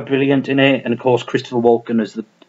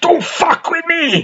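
much higher, slow, crazed cry; Dennis Hopper impression; slower than usual. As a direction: howl it like a man high on gas. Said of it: "Dennis Hopper with his gas, going".

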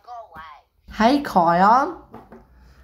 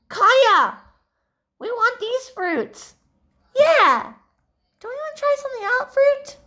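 put-on high girl voice; 13 semitones higher than usual. High-voiced friends protest.

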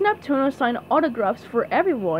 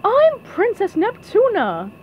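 high, slow, louder introduction; princess voice; 9 semitones higher, 5 dB louder than usual. A narrator's reading. Princess introducing herself to the crowd, high-voiced. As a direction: announce yourself grandly, high and slow.

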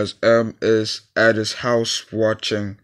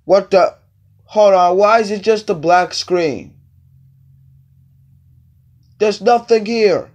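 high, loud confused outburst; shocked and baffled, loud and high; surprised; 8 semitones higher, 5 dB louder than usual. Acted.

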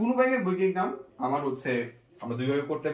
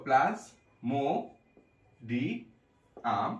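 slow syllables; much slower than usual. Shown as a demonstration. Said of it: "teacher splits word for writing".

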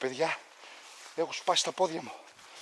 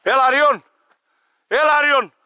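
loud, much higher call across the field; shouting for the dog; 10 semitones higher, 11 dB louder than usual. Real, not acted. Hunter shouting for his dog after a long shot. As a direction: yell the dog's name so it hears from far off.

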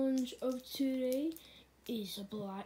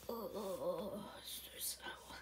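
weakened moaning; 5 semitones lower, 8 dB quieter than usual. Acted. Contestant suffers from sour candy.

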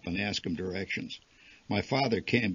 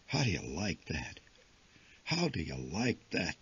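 hushed, low and much softer; softly; 4 semitones lower, 7 dB quieter than usual. A narrator's reading. Father marvelling softly before the great pumpkin. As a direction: murmur it in awe. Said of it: "my father said softly".

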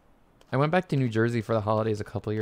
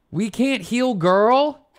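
incredulous; 9 semitones higher, 5 dB louder, much slower than usual. Real, not acted. Disbelief at game rule.

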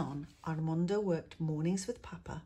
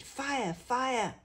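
high, urgent alarm shout; shouting fire; 8 semitones higher, 5 dB louder than usual. Acted. Neighbours warning the colonia with a high alarm shout. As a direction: yell a warning to everyone. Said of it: "people shouted".

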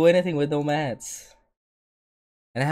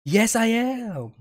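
drawn-out insistence; 9 semitones higher, much slower than usual. Real, not acted. Playful argument with girlfriend.

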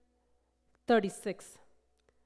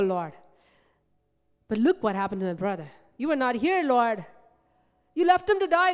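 reproachful plea; 4 semitones higher, 6 dB louder than usual. Acted. Martha reproaching Jesus, voiced by preacher.

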